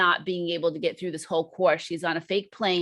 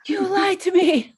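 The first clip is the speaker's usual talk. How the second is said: higher, louder, accusing in mock outrage; mock betrayed outrage; 12 semitones higher, 6 dB louder than usual. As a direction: hurl it at him as a hammed-up accusation. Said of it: "And she's like".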